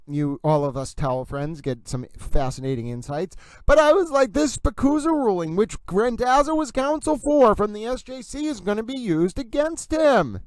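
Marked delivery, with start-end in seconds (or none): 3.7-10.4 high-pitched, louder excited rush of vindication; thrilled, voice jumping way up. Real, not acted. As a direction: crow about a prediction come true. Excitedly vindicated about own prediction.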